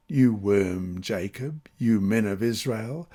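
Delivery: ordinary talk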